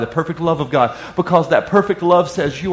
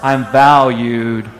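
very slow, drawn out; slow on purpose; 4 semitones lower, 3 dB louder, much slower than usual. Shown as a demonstration. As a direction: draw it out for them to echo. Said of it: "I'm gonna say it slow".

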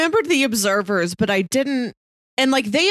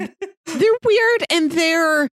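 laughing; 8 semitones higher, much slower than usual. Real, not acted. Echoing through laughter.

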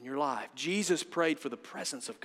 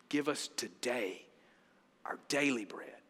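hushed prayer; 6 semitones lower, 7 dB quieter than usual. Acted. Preacher reciting the prayer as his lesson, hushed. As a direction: let it sink down reverently.

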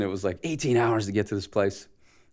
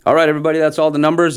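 higher, louder host voice addressing a room; announcer calling out; 8 semitones higher, 8 dB louder, faster than usual. Retold or imitated. Organizer announcing to the crowd, loud emcee call.

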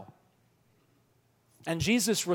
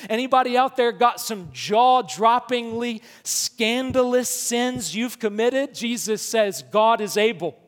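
higher and louder fervent preaching; full-force preaching; 5 semitones higher, 5 dB louder than usual. Acted. Preacher rousing the congregation about forgiveness, fervent and loud. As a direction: call it out to the whole congregation.

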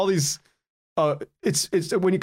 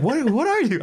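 higher-pitched, incredulous mock question; pitched-up imitation of an appalled manager; imitated baffled manager; 7 semitones higher than usual. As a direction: mimic a manager's disbelieving look. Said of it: "my manager's looking at me like".

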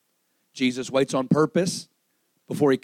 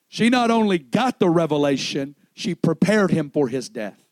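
raised, driving sermon voice; impassioned preaching; 7 semitones higher, 5 dB louder than usual. Real, not acted. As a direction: preach it high and forceful to the congregation. Preaches with full force.

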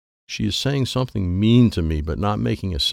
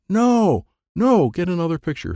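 urgent no; 9 semitones higher, 3 dB louder than usual. A narrator's reading. Troy urgently refusing in charades.

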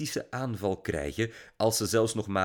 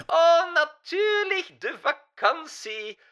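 put-on old German diva asking about the trip; German-accented diva; 6 dB louder than usual. A narrator's reading. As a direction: gush and ask like a German diva. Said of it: "vroeg Mila Mossa".

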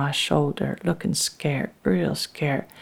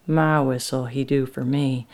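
plain reading voice

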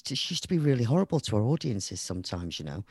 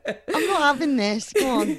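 squealing with laughter, mock envious, much higher and louder; laughing mock jealousy; 10 dB louder than usual. Host laughing to the musician about the Albert Hall, high mock jealous squeal. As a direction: play at being jealous through laughter.

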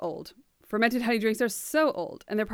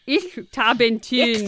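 slow, louder German-style "ich, Tabentyn"; German accent; 3 semitones higher, 6 dB louder, slower than usual. Shown as a demonstration. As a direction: lean into German sounds, umlaut on the last vowel. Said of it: "I just put an umlaut over it".

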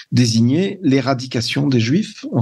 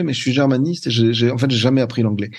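usual speaking voice

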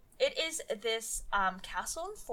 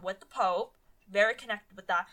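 ordinary delivery

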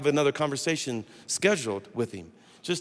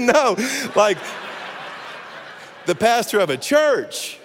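laughing correction; 6 semitones higher, slower than usual. Retold or imitated. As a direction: correct her through a laugh.

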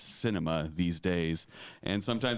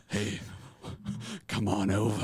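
creepy voice. Retold or imitated. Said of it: "Why did you do a creepy voice?".

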